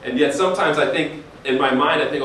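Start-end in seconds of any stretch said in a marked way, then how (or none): none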